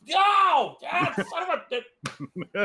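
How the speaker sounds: high, drawn-out cry of pain; acted pained shout. Player acts out character clamping own wound, pained shouting. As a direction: yell and curse through rough self-treatment.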